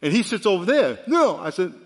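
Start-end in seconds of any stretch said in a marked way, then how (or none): 1.1-1.4 put-on child's defiant no. Grandson refusing bedtime milk.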